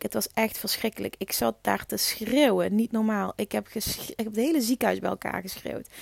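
ordinary talk, nothing marked

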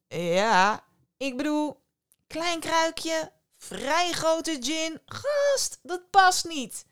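high, mocking fisherman; fisherman taunting. A narrator's reading. Fisherman mocks the djinn. (spottende, hoge vissersstem)

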